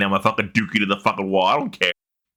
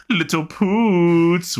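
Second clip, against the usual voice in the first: slow, stretched, high cartoon voice; big cartoon man voice; 8 semitones higher, much slower than usual. Greeting the co-host in a big cartoon man's voice. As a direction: draw it out like the cartoon guy. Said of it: "repeats the cartoon line".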